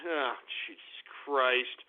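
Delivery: low, slow exasperated groan. Real, not acted. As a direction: sigh it out in disbelief. Reacting to a troll username.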